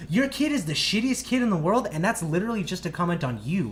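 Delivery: loud, high-pitched telling-off; retold screaming tirade. Teen berates the kid's mom at the pizza place, yelling. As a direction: re-enact tearing into someone publicly. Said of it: "screaming at the top of my lungs".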